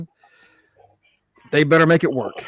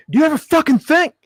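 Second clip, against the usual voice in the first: high, raised-voice imitation of a telling-off; angry scolding; 12 semitones higher, 4 dB louder than usual. Imitating Chase berating his team, exasperated yell. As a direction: snap it at them, pitched way up.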